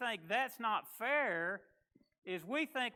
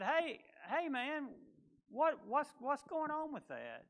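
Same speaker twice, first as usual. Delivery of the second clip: confused casual pal; 5 semitones higher, 3 dB quieter than usual. Retold or imitated. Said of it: "It was like a confusion to him".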